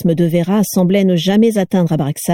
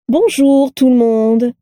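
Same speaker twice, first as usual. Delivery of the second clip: high, slow sing-out hello; joyful greeting; 5 semitones higher, slower than usual. A narrator's reading. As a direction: greet the whole room exuberantly, stretching it. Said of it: "s'exclama-t-elle joyeusement". (salutation joyeuse et chantante)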